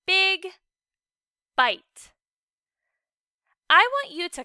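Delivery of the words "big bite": big and bite said loudly, each on its own; big with a short I, then bite with a long I; model words. Shown as a demonstration. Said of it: "Say those words".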